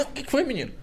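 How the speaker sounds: high curious mother's question; mother pressing her son for news, high and curious. Retold or imitated. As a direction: ask like a nosy mum, pitched well up. Mother voiced in the story.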